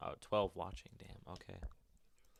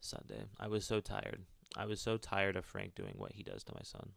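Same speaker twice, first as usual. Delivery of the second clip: steady storytelling read; story narration. Acted. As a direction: narrate the character's thoughts evenly. Reads game character's inner monologue.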